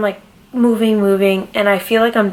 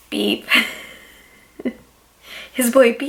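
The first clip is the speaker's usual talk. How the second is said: laughing; 4 semitones higher than usual. Real, not acted. Laughing at the name.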